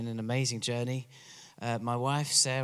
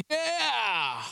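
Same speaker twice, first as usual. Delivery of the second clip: a higher whoop of "Yeah!" the way Americans cheer; 11 semitones higher than usual. Retold or imitated. Preacher showing his church how Americans cheer, higher whoop. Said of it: "you kinda go".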